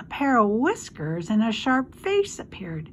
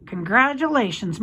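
plain reading voice

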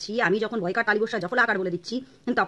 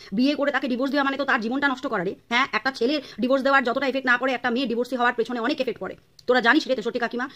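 ordinary talk, nothing marked

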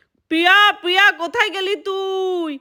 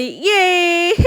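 high yay, then a high giggle; happy whoop and giggle; 4 semitones higher, much slower than usual. Acted. Playing alone happily.

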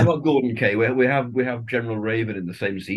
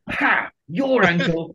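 crowing, high-pitched jeer; a mock triumphant "ha!", pitched up; gloating Sarah; 8 semitones higher, much slower than usual. Sarah stands there mocking his knackered ankle, gloating. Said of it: "Sarah stands there going".

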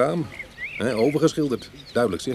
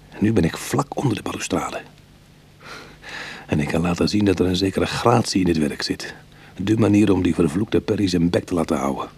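story narration; 4 semitones lower than usual. A narrator's reading. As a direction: tell it like a memory, low and even. Ik-verteller van het verhaal. (rustige vertelstem van de inbreker)

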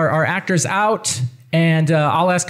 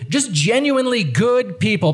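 normal talking voice

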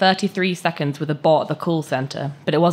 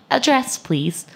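higher, synthetic call-centre bot; robotic bot voice; 9 semitones higher, slower than usual. Acted. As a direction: read it like an automated phone assistant. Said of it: "bot's robotic voice".

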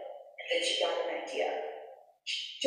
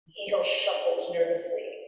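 plain reading voice

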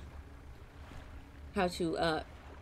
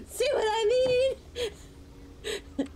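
laugh-crying. Real, not acted. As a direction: squeak through the laughter.

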